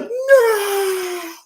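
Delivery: high, drawn-out wail of denial; mock scream. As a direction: scream it like a fan losing his mind. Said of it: "It's like".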